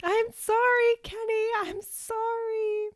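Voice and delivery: very high, drawn-out sorry; loud, squeaky over-the-top apology; high-pitched apology. Retold or imitated. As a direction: wail the apology, stretching each sorry. Apology called out after embarrassing moment.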